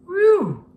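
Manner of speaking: loud high whew at a bad smell; disgusted whew. A narrator's reading. George reacting to the skunk spray, loud disgusted whew.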